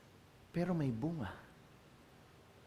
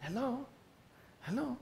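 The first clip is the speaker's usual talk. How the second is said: soft; 4 semitones higher, 16 dB quieter than usual. Acted. Soft calling to crowd.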